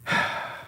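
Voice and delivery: long, breathy sigh; heavy sigh. Real, not acted. Co-host hearing about the next batch, heavy sigh. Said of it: "Heavy sigh".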